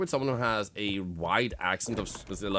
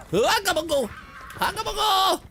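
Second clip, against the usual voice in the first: very high, loud battle cry; high yell; 7 dB louder than usual. Pretend. Player yelling a cry in the game, high shout.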